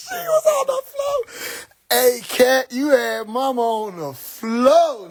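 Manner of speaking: high, slow words through laughter; cracking up while telling it; laughing almost crying; laughing hard. Real, not acted. Laughing retelling mom on floor.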